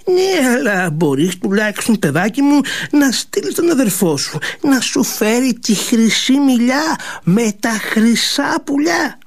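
lightly voiced sly old maid; coaxing old servant. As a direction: tempt the girl sweetly.

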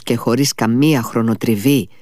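usual speaking voice

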